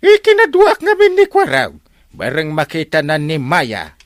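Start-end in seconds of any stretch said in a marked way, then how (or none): 0.0-1.7 high squealing laugh into the line, louder; giddy laugh. Acted. Captain giggling about bringing his nephew along, high and loud.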